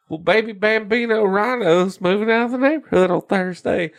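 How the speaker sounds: chirpy cartoon animal announcing a party; cutesy villager voice.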